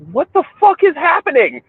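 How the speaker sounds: high, panicked question in her voice; a hammed-up girl's alarmed cry; mock yell of the girlfriend. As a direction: squeak it out like a scared country girl. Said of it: "yells in my ear".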